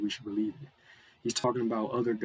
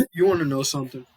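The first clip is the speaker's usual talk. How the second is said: laughing; 3 semitones higher, 9 dB louder than usual. Real, not acted. Laughing before his point.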